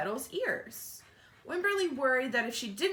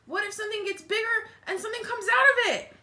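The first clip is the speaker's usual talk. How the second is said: put-on much higher, anxious kid voice; worried child; 12 semitones higher, 6 dB louder, faster than usual. Wimberly fearing the tree, high worried child voice. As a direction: fret like a small child.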